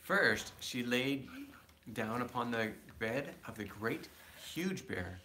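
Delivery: ordinary delivery, nothing marked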